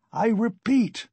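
high, indignant outburst; furious cry. A narrator's reading. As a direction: cry out in acted anger. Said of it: "Raskolnikov cried furiously".

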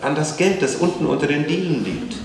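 put-on high, eager child's voice; insistent child. A narrator's reading. Child explains about hidden money.

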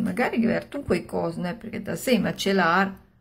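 usual speaking voice

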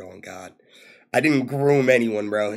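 ordinary talk, nothing marked

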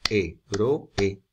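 héroe as HÉ... RO... E; three separate beats in héroe; split into syllables. Shown as a demonstration. Teacher sounding out an esdrújula, héroe split as hé-ro-e. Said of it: "es una palabra esdrújula".

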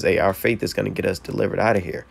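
normal talking voice

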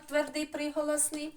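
normal talking voice

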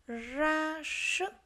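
"Ra sức" high, rolled R and long hushed S; careful R and S. Shown as a demonstration. Teacher modelling "Ra sức" for pupils, strong R and S. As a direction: draw out the R of "Ra" and S of "sức". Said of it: "nghe cô đọc lại các từ này".

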